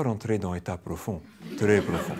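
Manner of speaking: slow, broken-French mock Russian accent, laughing at the end. Shown as a demonstration. Trainer jokingly hypnotizes a volunteer, mock Russian accent. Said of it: "avec un accent russe".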